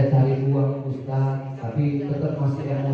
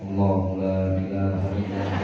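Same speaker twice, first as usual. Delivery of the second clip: calm dhikr; 6 semitones lower than usual. Acted. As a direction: murmur it serenely while he rages. Wife reciting dhikr with tasbih.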